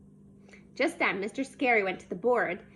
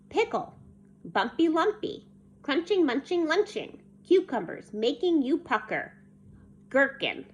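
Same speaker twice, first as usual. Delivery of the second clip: measured recital, each line set apart; poem recital; 3 semitones higher than usual. A narrator's reading. Teacher's pickle poem read to class, measured recital. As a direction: recite it line by line, starting high. Reads the example poem aloud.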